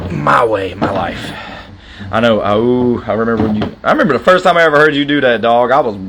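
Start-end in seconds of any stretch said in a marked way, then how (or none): none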